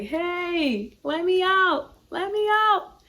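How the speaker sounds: high, pleading voice calling from inside; inner little voice. Retold or imitated. Inner voice begging to be let out, high and pleading. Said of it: "that little voice like".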